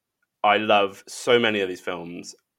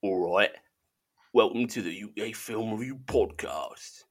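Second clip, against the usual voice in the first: a quiet, half-hearted try at a Cockney accent; a shaky Cockney accent he says sounds rubbish; Cockney accent attempt; 6 dB quieter than usual. Shown as a demonstration. Host retries the podcast intro for Brian, tentative Cockney accent. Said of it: "I was gonna do that in a Cockney accent".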